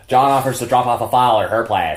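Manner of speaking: put-on parodying the trailer line in a clumsy American accent; mock American accent. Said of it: "it's so bad".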